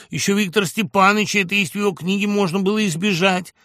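high, loud, pressing oratory; insistent self-defence. A narrator's reading. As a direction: keep hammering your innocence at the crowd. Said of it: "напирал Жухов". (напористая оправдательная речь)

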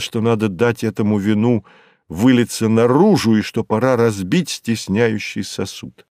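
ordinary delivery

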